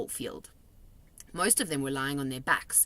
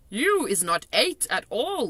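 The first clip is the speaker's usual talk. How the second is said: higher, louder friendly giant voice; kindly giant, raised voice; BFG giant voice; 6 semitones higher, 6 dB louder than usual. A narrator's reading. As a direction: play the big friendly giant, chatty and odd.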